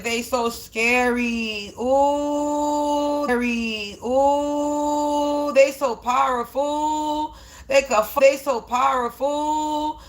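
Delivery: drawn-out sarcastic fake fear; mock awe. Speaker mocking haters as scary and powerful, sarcastic mock awe. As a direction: stretch the words in mocking, fake wonder.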